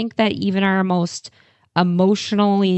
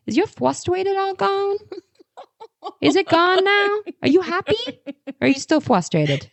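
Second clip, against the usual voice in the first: put-on much higher, cute toddler voice with a laugh; little kid voice. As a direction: play the curious youngest child. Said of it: "will be like".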